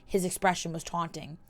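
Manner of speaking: ordinary delivery, nothing marked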